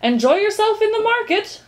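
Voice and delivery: high, loud parting call; shouting back. A narrator's reading. Departing undersecretary takes leave of Milo, shouting back. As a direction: call it back over your shoulder. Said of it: "shouted back the undersecretary".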